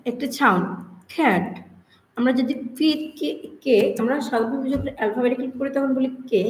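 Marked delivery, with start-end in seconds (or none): 1.1-1.5 "খ্যাট" with a puff of air on K; aspirated K. Shown as a demonstration. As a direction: demonstrate "খ্যাট", breathy K onset. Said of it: "এটা হচ্ছে ইংরেজি বর্ণমালার". ("খ্যাট"-এর খ মহাপ্রাণ উচ্চারণে)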